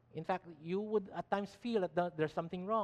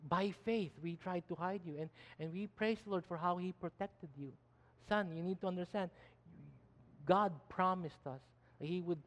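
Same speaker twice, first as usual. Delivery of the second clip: soft, confiding talk to a son; tender mother; 5 dB quieter than usual. Acted. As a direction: quietly remind your child of the past. Voicing mother teaching her young son.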